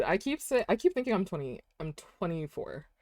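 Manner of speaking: normal talking voice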